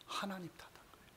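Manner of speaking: breathy whispered aside. Real, not acted. Preacher whispers to the congregation who Adam blamed. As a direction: drop to a whisper to make the point.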